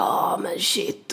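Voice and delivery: slow, soft drawn-out "Ah, men shit"; a tipsy fan, awed and slurring; drunk starstruck exclamation. Retold or imitated. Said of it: "ganska full Emily som bara".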